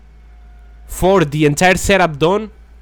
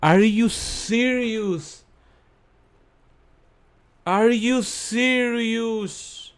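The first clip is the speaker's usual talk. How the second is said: exasperated disbelief; 7 semitones higher, 4 dB quieter, much slower than usual. Real, not acted. Lost a 38-minute recording.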